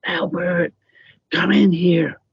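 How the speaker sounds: retold urgent call, pitched high; mother calling out. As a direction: imitate an alarmed mother summoning her husband. Mother calls father after screaming.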